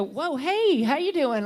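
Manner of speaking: sudden loud, high mock startle; startled greeting. To a partner never really connected with, mock startled hello. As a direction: jolt as if you just noticed your partner. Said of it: "and then go".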